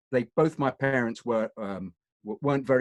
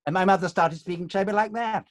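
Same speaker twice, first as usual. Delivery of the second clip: high, over-elocuted RADA English; affected RADA accent; 7 semitones higher, 3 dB louder than usual. Retold or imitated. Son mimics mum after her drama course, affected RADA accent. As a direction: imitate mum's put-on RADA accent. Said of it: "She did a rada course".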